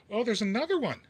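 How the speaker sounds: loud, high burst of dismay; alarmed discovery. Real, not acted. Spotting another fault on the guitar, alarmed. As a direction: jump on it, pitch leaping up. Suddenly spots another flaw.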